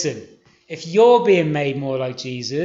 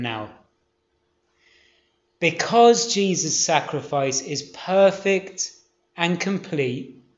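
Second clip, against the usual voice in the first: measured delivery, long pauses between phrases; slow summary; 2 semitones lower, 3 dB quieter than usual. Real, not acted. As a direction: say it slowly, phrase by phrase, letting each point land. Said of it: "I'm gonna say this slowly".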